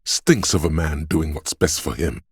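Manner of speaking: ordinary delivery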